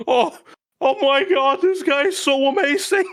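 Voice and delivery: high-pitched swooning admiration; squeal-like mock fawning, voice way up; gushing fans. The pack adores its leader, gushing. Crowd gushes over the leader.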